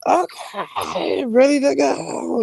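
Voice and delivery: high, slow, eager mock voice; mimicking eager girl. Mocking the pushy cruise girl, high and eager. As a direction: play the pushy stranger, overly eager. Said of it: "scouted me out and was like".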